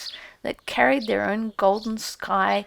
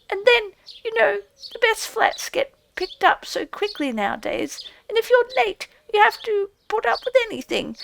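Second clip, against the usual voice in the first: high, squeaky, sheepish mouse voice; shamefaced field mouse; 11 semitones higher, 4 dB louder than usual. A narrator's reading. Field mouse excusing itself to Rat, shamefaced and squeaky. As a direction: own up sheepishly in a tiny high voice.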